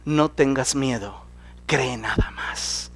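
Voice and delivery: calm him tenderly; reassuring. Retold or imitated. Jesus comforting Jairo, soft reassurance. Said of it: "le dijo a Jairo".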